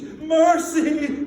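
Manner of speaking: loud desperate cry for mercy; cried out. A narrator's reading. Scrooge begging the ghost on his knees, crying out. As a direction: fall to your knees and cry out. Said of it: "he cried".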